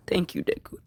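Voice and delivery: a crying mother's whispered thanks, soft and voiceless; tearful whisper. Retold or imitated. Mother thanking her rescued son, tearful whisper. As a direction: breathe it out through tears. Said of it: "she's crying and she's like".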